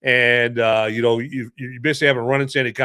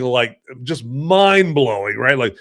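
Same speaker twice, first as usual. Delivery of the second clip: ordinary talk, nothing marked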